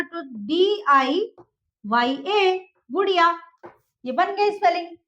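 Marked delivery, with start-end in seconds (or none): 0.4-2.7 slow spelling. Shown as a demonstration. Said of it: "मैंने आई बताया था".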